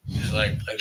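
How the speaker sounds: usual speaking voice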